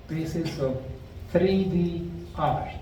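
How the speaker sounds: ordinary talk, nothing marked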